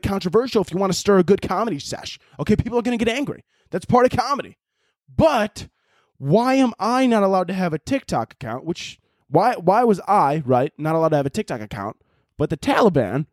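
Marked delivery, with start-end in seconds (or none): none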